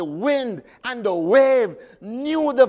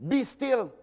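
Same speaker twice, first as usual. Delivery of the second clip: commanding Jesus; 4 semitones higher, slower than usual. Acted. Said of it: "Jesus said to the storm".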